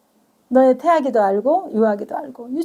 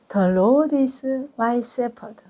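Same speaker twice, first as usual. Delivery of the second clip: each word drawn out very slowly; slow recitation; 3 dB quieter, much slower than usual. Acted. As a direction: recite the verse slowly, like teaching it. (아주 천천히 또박또박 읽는 성경 구절)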